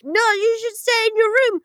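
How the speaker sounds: put-on high, insistent child voice; mock children. Kids telling the nanny to stay put, high mock child voice. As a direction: pitch it up like kids giving orders. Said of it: "And they're like".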